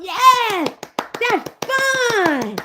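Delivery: high, squealing yes; mock excitement. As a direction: gush like a giddy fan. Mocking excited fridge fans.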